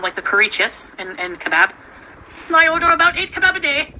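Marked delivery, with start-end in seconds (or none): 2.5-3.9 put-on a clumsy stab at a British accent, pitched higher and louder. Mimicking a British kebab-eater, a badly done British accent. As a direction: try a British accent and miss it. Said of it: "I can't do an accent at all".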